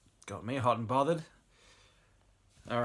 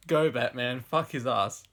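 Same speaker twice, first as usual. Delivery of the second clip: loud, punchy egging-on; mock screamed cheer; 4 semitones higher, 12 dB louder, slower than usual. A narrator's reading. Woman urging on Batman in the story, mock screamed cheer. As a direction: cheer loudly as a reader acting it out. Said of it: "masturbating and screaming".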